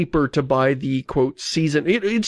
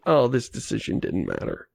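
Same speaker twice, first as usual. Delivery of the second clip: deflated thought; 4 semitones lower, 5 dB quieter than usual. Retold or imitated. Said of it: "I was like".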